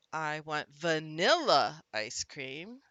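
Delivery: "vanilla" punched loud on its middle syllable, slowly; stress on vanilla. Shown as a demonstration. Teacher moves the punch along slowly, stress on "vanilla". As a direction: lean hard into "vanilla". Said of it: "We're gonna punch this one".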